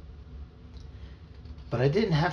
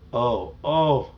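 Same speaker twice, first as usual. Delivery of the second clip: louder, stretched uh-oh; dismayed realization; 6 dB louder than usual. Acted. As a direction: react to a disaster just witnessed. Boy watches brother vanish in a flash.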